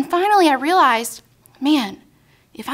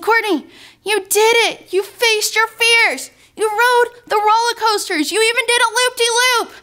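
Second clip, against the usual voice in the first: higher, delighted cheering; proud congratulation; 7 semitones higher than usual. Retold or imitated. Friend congratulating the rider after the ride, excited praise. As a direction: gush with a friend's pride.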